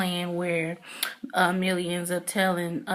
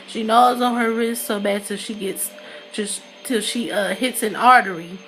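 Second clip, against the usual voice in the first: ordinary delivery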